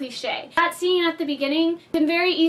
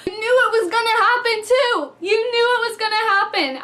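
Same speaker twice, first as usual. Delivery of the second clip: higher and louder, bursting with glee; excited; 6 semitones higher, 4 dB louder than usual. Real, not acted. Reviewer recalling Kurt's cliff jump, excited and gleeful. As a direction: gush like it's happening again. Reliving the funny death.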